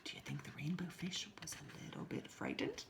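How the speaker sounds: hushed spooky question; whisper. Real, not acted. Teacher whispering a question to the children. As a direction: whisper to build suspense.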